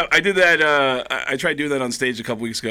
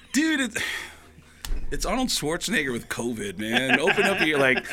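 higher-pitched retort to critics; super defensive; 6 semitones higher than usual. Retold or imitated. Comic answering critical friends about his voices, mock defensive. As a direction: protest in mock indignation. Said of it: "I'll get super defensive".

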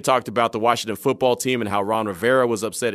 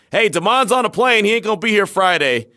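much higher and louder, a chirpy mock coworker; coworker's call; 9 semitones higher, 5 dB louder than usual. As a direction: play the cheerful coworker with bad news. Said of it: "a coworker saying".

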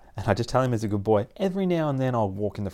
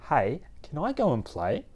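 politely asking dog; 6 semitones higher, 4 dB quieter than usual. Retold or imitated. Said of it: "have the dog sit, look at you and ask".

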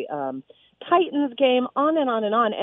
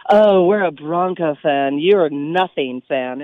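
lower, slow, smug Bronco-fan sneer; mock arrogant fan; 6 semitones lower, slower than usual. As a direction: sneer down at a rival fan, drawn out. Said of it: "the blatantness".

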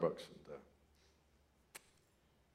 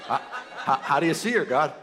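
laughing; 9 semitones higher, 13 dB louder than usual. Retold or imitated. Asking God, laughing.

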